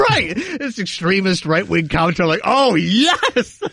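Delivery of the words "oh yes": high, drawn-out delighted cry; gleeful cheer. Retold or imitated. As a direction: crow with delight through a laugh. Comics delighted by extremist target.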